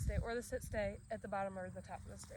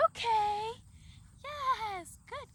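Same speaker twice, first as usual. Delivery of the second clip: much higher, bright upward release call; high-pitched, excited release cue; high-pitched release word; 11 semitones higher, 6 dB louder, much slower than usual. Real, not acted. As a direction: lift the voice way up to excite the dog. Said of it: "kinda more in a high-pitched tone".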